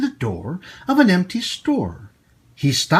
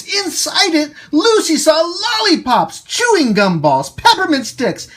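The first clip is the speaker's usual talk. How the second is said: higher, thrilled list of sweets; excited narration; 11 semitones higher, 3 dB louder than usual. Acted. Host reading the candy reveal to kids, excited. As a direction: build the wonder item by item.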